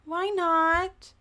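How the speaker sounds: put-on high-pitched little kid question; puzzled small child. Five-year-old asks why families don't speak, high child voice.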